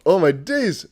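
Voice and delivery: usual speaking voice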